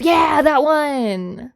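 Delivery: loud, drawn-out yeah; thrilled recognition; excited yes. Real, not acted.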